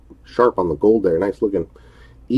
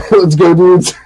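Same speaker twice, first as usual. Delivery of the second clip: laughing; 8 semitones higher, 8 dB louder, slower than usual. Real, not acted. Laughing while starting the break.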